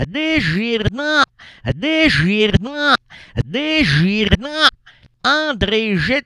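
high, slow, stretched syllables chanted in a loop; exasperated drilling. Acted. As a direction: hammer each syllable out for a slow learner. Keeps drilling the correction. (répétition exaspérée, syllabe par syllabe)

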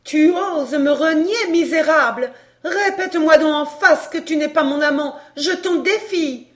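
high, loud, outraged challenge; enraged defiance. A narrator's reading. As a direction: confront a lover who disowns you, shaking a fist. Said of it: "lui dit en lui montrant le poing". (défi lancé avec rage)

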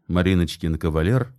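usual speaking voice

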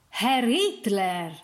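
drawn-out call down a corridor; long, carrying call. A narrator's reading. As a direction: summon someone by name, slowly. Doctor calls into the corridor.

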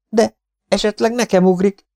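high, loud worried voice; fearful objection. A narrator's reading. Partisan fears the scorpion will jump at him, fearful. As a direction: protest anxiously, pitch way up.

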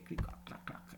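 soft whispered creaking sounds; whispered creaks. A narrator's reading. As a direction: click the wooden joints in a whisper. Stiff legs creaking.

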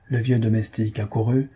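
ordinary delivery, nothing marked

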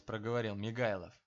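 plain reading voice

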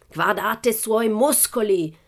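high, slow showman's exclamation; drawn-out ringmaster cry; circus director exclaiming. A narrator's reading. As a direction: show him off proudly to the crowd. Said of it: "esclamò il direttore del circo".

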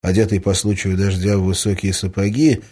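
ordinary delivery, nothing marked

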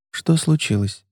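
hushed, gentle concern; soft worried question. Acted. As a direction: ask softly, afraid to intrude. Anika gently asks crying colleague.

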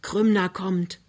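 plain reading voice

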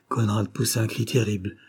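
usual speaking voice

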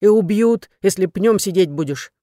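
loud, indignant retort; angry yelling. A narrator's reading. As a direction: shout the rebuke at him. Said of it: "заорала Танька".

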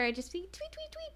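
high chirpy tweet tweet; bird tweeting sound. Shown as a demonstration. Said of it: "Or I'll just do that".

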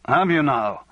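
put-on thick-tongued drunk; slurring it a little, drunk. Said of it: "Raymond said thickly".

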